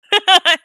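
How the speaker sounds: short, high, loud laugh; quick burst of laughter. Real, not acted. Laughs while greeting chat members.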